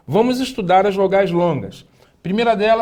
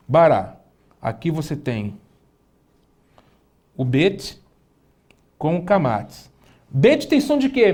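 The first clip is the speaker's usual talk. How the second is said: ordinary talk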